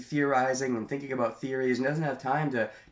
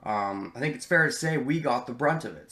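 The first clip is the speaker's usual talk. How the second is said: normal talking voice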